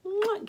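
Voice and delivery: high, quick mwah; squeaky little smooch; blown kiss. Pretend. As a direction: throw a short playful kiss. Blows one more kiss.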